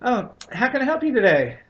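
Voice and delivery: high, sing-song service voice; chirpy desk lady. Retold or imitated. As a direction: imitate the cheerful shelter receptionist. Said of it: "the lady at the desk is like".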